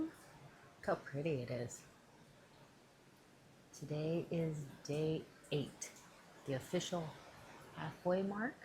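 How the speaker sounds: hushed early morning voice; whispering. Real, not acted. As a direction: keep it low, people sleeping.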